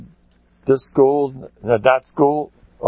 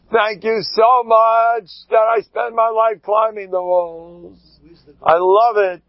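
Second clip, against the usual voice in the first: ecstatic thanks; 8 semitones higher, slower than usual. Pretend. Grateful man thanking God for hardship, high shouting.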